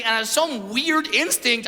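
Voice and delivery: normal talking voice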